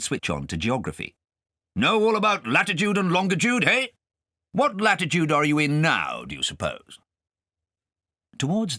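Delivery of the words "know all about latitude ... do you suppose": put-on high, loud blustering old gentleman; pompous guardian. Guardian quizzing Kay at dinner, pompous and loud. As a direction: quiz the boy grandly.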